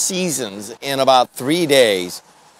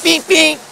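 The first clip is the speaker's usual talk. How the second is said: high, much higher mimicked car beep; imitated horn beep. Shown as a demonstration. Host imitating a passing car's horn, high beeps. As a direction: copy the sound of a car horn. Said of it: "what'd that beep sound like?".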